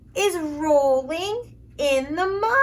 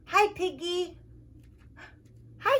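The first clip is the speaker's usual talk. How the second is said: ordinary delivery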